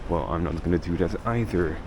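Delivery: ordinary talk, nothing marked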